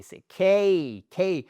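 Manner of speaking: 'quai' as a punched English 'kay'; firm falling glide on each 'quai'; English diphthong. Shown as a demonstration. Teacher insisting on the correct sound, emphatic gliding 'kay'. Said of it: "C'est pas la clé".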